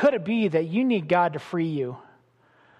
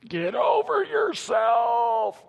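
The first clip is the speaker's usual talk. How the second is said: forceful shout; 7 semitones higher, much slower than usual. Real, not acted. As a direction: bark it out slowly at the crowd. Preacher rebukes congregation.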